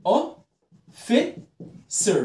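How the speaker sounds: "officer" very slow, as "o... fi... cer"; long pauses between the syllables of "officer"; high, separated syllables in "officer". Shown as a demonstration. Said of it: "تعال نقسمها".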